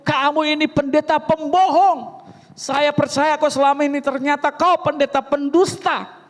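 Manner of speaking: jab each insult at the pastor; angry man berating the pastor; scolding. Retold or imitated.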